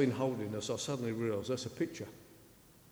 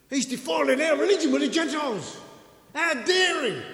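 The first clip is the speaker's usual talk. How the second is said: much higher, louder indignant cry; outraged protest; 11 dB louder than usual. Acted. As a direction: voice the crowd's fury at Paul. Outraged crowd quoted.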